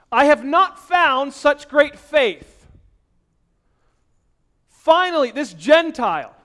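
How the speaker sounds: fiery preaching. Acted. Preacher voicing Jesus praising the centurion's faith, loud and high. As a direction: proclaim it with great energy.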